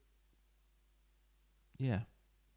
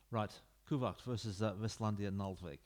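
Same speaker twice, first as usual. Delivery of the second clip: ordinary delivery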